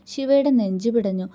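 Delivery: plain reading voice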